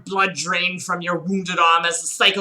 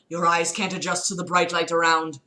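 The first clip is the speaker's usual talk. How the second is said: ordinary talk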